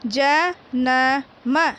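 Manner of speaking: जन्म split into ज-न-म, very slow; letter by letter. Shown as a demonstration. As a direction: stretch जन्म out syllable by syllable. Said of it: "पहले एक एक वर्ण को पढ़ना है".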